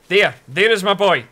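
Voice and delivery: loud, high, delighted call; calling out to a favourite villager; excited greeting. Real, not acted. Streamer calling to her villager, loud and delighted.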